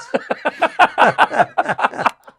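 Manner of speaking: high burst of laughter; laughing at his own joke; loud laugh. Real, not acted. Big laugh.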